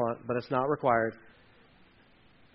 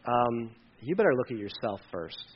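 softer, lower, calm correction; gentle rebuke; 8 semitones lower, 8 dB quieter than usual. Retold or imitated. Jesus gently telling the demanding believer, calm and low. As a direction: quietly turn the question back on them. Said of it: "And Jesus is like".

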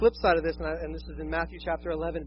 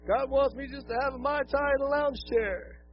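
mock smug excuse; 7 semitones higher than usual. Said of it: "you're like".

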